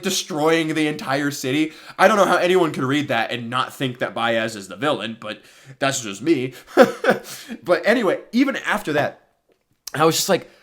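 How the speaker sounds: ordinary talk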